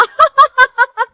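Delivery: high, rapid cackle; mocking laugh. Wife laughing at rival's mango folly, mocking laugh. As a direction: burst out in high derisive laughter.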